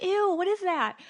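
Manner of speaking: high, squeaky mock disgust; a hammed-up ew at the mirror; grossed out. Teen spotting cystic acne, high mock disgust. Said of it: "and you're just like".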